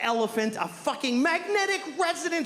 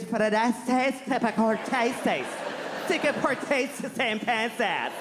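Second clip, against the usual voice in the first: gibberish; 6 semitones higher, slower than usual. Pretend. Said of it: "she just hears".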